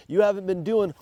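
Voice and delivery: normal talking voice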